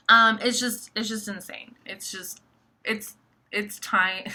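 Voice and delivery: ordinary delivery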